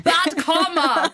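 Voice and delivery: "Bad comma" in a slow, hammed-up British accent; slow half-laughing fake British; mock British accent. Host jokingly tries a British accent again, slow and high. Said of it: "what accent was that?".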